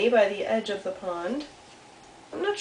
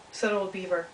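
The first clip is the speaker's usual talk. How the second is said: ordinary delivery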